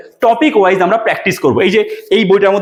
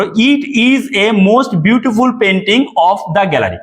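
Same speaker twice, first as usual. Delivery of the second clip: each word of the sentence drawn out very slowly; slow dictation; much slower than usual. Shown as a demonstration. Teacher repeats the faulty sentence slowly for students. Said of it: "আমি লিখে দিচ্ছি লেখাটা".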